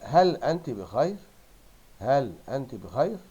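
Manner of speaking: "هل أنت بخير" slow, leaning on "هل", rising at "بخير"; deliberate rising question on "هل أنت بخير"; questioning tune. Shown as a demonstration. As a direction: ask it loud and clear, lifting "بخير" at the end. Models the question from text.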